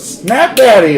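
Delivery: high, loud gleeful yelp; excited outburst. Acted. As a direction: burst out with pure joy. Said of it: "And Tuna goes".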